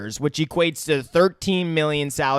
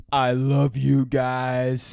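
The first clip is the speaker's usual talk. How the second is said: slow, low monotone Groot voice; imitated Groot line; 6 semitones lower, much slower than usual. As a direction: drag each word out low and slow like Groot. Quoting Groot's new line.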